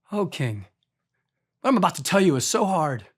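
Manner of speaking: soft, low and sorrowful toward the king; Daniel gently breaks hard news to the king, softly; respectful. Retold or imitated. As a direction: gently soften the blow. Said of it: "Look at the respect in that".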